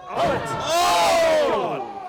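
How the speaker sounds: high, loud, drawn-out cry; shocked shout. Real, not acted. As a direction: cry out at the sudden counter. Reacting to a sudden counter.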